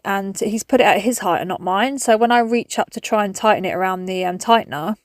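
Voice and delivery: ordinary talk, nothing marked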